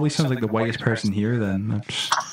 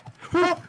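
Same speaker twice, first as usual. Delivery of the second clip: sharp high gasp of fright; startled gasp. Real, not acted. Streamer gasps as a zombie breaks in. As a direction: gasp as something bursts in.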